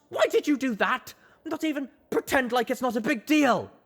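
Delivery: half sobbing, half laughing, spiralling up; hysterical half cry. Acted. Colt bitterly confessing to Rampage, hysterical cry. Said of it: "a hysterical half cry, half laugh".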